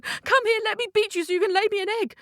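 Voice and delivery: high, quick, urgent demand; frantic chasing man. Acted. Hungry man chasing his cockerel round the house, frantic. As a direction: call out fast and high, mid-chase.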